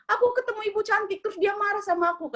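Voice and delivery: high sweet coaxing voice; flattering maid voice. Retold or imitated. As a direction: play the sly maid buttering up the boss. Maid voice recounting dream. (suara pembantu merayu, nada tinggi)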